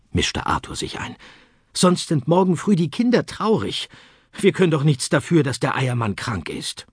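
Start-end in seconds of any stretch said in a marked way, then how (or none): 1.7-6.9 put-on pleading boy. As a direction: beg the hens sweetly. Arthur pleads with hens.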